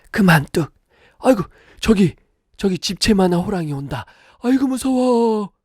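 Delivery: high granny voice, faking fright; mock-scared grandmother. Grandmother pretending a tiger is coming, mock fright. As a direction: pretend panic like a granny spooking the baby.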